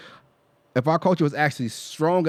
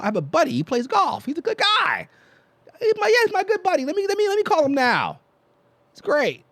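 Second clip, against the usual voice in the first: much higher, fast put-on dad voice. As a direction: squeal it like an oblivious golf buddy. Mocking a racist's excuse.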